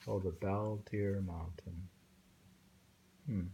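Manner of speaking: ordinary delivery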